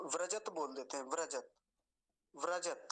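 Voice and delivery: व्रजत clipped to vra-jat, final t bare; mistaken pronunciation. Shown as a demonstration. Teacher quoting scholars' frequent mistake, mistaken pronunciation. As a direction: cut व्रजत off on the t. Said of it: "गलत है".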